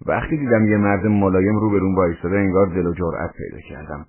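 ordinary delivery

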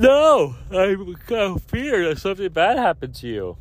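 mock-anxious late-night reply; worried self-quote. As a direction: voice himself high and panicky, half-mocking. Retells his anxious late-night text.

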